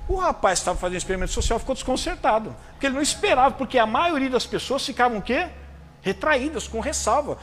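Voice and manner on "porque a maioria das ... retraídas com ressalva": ordinary talk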